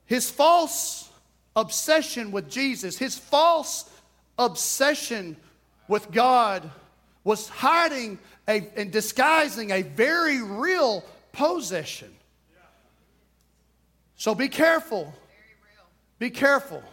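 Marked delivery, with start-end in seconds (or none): none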